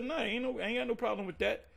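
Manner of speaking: high, unbothered brush-off; breezy defiance. Retold or imitated. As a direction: toss it off like nothing can touch you. Shrugging off the punishment.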